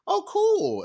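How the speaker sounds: mock high-pitched "oh, cool"; friend's polite reaction. Friend reacting to her agent news, politely high. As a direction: put on a high, feigned-interest friend voice.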